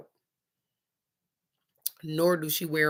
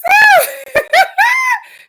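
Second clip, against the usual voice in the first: huge high shrieking laugh, much louder; screaming laugh; 13 dB louder than usual. Real, not acted.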